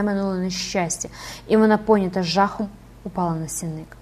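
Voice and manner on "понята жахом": ordinary delivery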